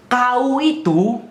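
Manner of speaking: high, loud and slow mock rebuke; scolding cop. Cop scolding the driver who asked, slow mock rebuke. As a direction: drag it out like a bossy officer. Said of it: "ini kata polisinya".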